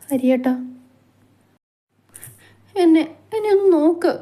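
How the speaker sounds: high, pleading tearful voice; wife pleading with Hari, crying; tearful plea. Acted. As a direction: beg him to look at her through tears. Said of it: "ഒഴുകുന്ന കണ്ണുനീരിനെ തുടച്ചുകൊണ്ട്".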